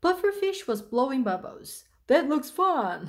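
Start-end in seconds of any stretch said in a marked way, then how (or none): 2.1-3.1 bright-eyed exclamation, higher and louder; delighted, mock laughing. A narrator's reading. Smiley Shark admires Pufferfish's bubbles, delighted. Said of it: "laughed Smile Shark".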